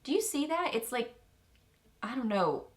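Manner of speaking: ordinary delivery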